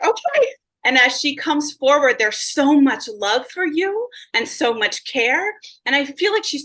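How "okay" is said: startled okay. Retold or imitated. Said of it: "I'm, I'm like".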